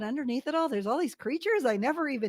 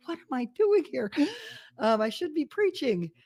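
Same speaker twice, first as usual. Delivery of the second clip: guilty alarm; 5 semitones higher than usual. Retold or imitated. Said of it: "being like".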